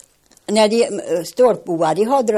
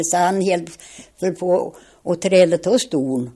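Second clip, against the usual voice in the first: ordinary talk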